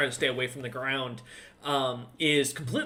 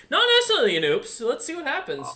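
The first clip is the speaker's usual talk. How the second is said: gleeful; 11 semitones higher, 5 dB louder, faster than usual. Real, not acted. GM gleeful at chaotic roll.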